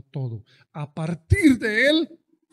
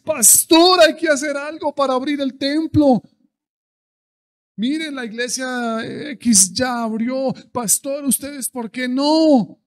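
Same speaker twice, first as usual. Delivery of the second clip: much higher, louder nagging plea; impatient congregant; 8 semitones higher, 10 dB louder than usual. Retold or imitated. Member nagging pastor about reopening, high whining. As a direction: whine at the pastor to open up. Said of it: "Tres meses".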